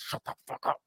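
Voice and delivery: hissed through gritted teeth, no voice; a mother silently threatening mid-call; gritted-teeth whisper. Retold or imitated. Mom pulls the phone away to threaten her kids, whispered through teeth. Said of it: "grits her teeth at you and just goes".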